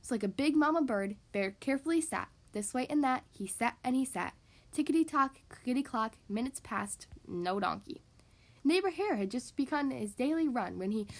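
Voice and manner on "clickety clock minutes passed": ordinary delivery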